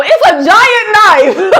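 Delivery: loud, high, drawn-out giggly outburst; laughing exasperation. Real, not acted. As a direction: give up and blurt it loudly through laughter. Gives up finding the word.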